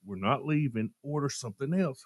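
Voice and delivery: retold quiet reprimand; hushed firm order. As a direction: lay down the law under your breath. Carla's embarrassed order, retold.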